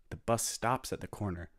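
plain reading voice

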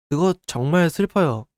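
usual speaking voice

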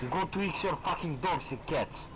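usual speaking voice

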